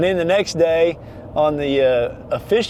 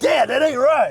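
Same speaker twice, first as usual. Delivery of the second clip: acted quote voice; 9 semitones higher than usual. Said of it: "He's like".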